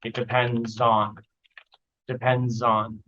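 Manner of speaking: "depends on" run together into a zon; "depends on" as dependzon; linked "depends on". Shown as a demonstration. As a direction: link the s into "on". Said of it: "becomes like a zon sound".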